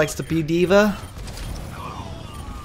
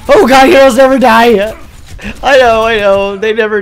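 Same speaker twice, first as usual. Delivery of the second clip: much louder, higher triumphant yell; loud excited shout; 4 semitones higher, 12 dB louder than usual. Real, not acted. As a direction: belt it out like a battle cry. Shouting in-game voice line.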